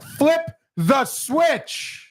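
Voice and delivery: high, drawn-out exasperated demand; exasperated command. Real, not acted. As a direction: stretch each word at your slacking producer. Exasperated at producer's slow switching.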